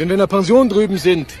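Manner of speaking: tinny voice through a phone line. Acted. Anruf über Telefonzelle. (Stimme durchs Telefon)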